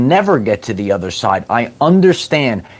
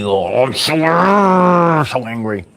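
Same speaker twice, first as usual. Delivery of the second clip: mock angry person; 2 semitones higher than usual. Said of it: "overly serious and angry".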